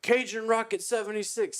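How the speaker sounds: very high, imitated track announcer; announcer call. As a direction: sing out the nickname like an announcer. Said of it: "He's like".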